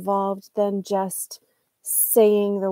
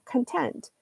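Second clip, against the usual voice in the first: content as con-TENT, meaning satisfied; stress on second syllable; 5 semitones higher, 5 dB quieter than usual. Shown as a demonstration. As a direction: land on TENT in content, first syllable reduced. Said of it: "on the second syllable".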